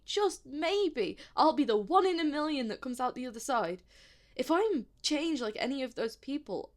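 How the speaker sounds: agitated, loud and urgent; panicked rant. Acted. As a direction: spiral into panic without stopping for breath. Panicked rant about dying.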